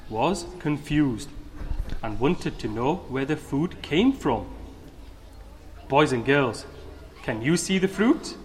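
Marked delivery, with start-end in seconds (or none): 5.9-8.4 put-on bright, upbeat talk to children; kids show host. Host asks children questions.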